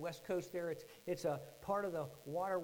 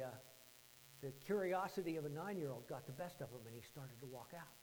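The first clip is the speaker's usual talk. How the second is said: ordinary talk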